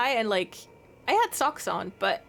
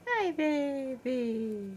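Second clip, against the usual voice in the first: slow cooing; 3 semitones higher, much slower than usual. Real, not acted. Owner cooing a drawn-out hello to her cat. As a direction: stretch each word long and tender.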